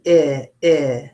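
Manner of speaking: short "eh" repeated; 重复短音"eh". Shown as a demonstration. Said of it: "the one that goes eh".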